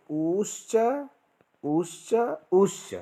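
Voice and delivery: ऊश्च repeated short, long, then prolonged; three lengths of u. Shown as a demonstration. Teacher chanting the three vowel lengths. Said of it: "तीन प्रकार के जो ऊ".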